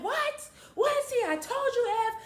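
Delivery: imitating a furious mom; outraged mom. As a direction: shriek I told you.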